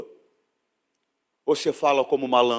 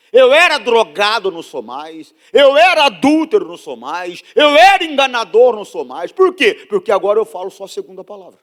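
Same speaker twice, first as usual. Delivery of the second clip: loud, high testimony chant, dropping on each refrain; fervent preaching; 7 semitones higher, 10 dB louder than usual. Acted. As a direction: hit each confession hard, then ease off. (testemunho pregado com força, em cadência)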